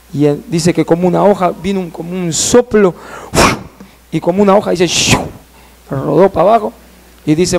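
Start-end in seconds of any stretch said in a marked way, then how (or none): 4.9-5.2 imitated "shiu" of falling; quick hissed swoosh; whoosh. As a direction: whoosh like a leaf blown away. Imitates a leaf being blown away.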